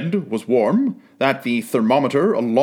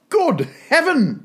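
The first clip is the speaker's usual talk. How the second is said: much higher, a burst of wonder; amazed exclamation; 13 semitones higher than usual. A narrator's reading. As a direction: cry out in astonished joy. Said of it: "clasping his hands together".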